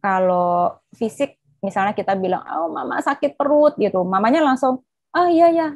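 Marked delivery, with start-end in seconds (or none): 2.4-3.8 put-on complaining child. 5.1-5.7 concerned mom. Retold or imitated. Mother responding with concern.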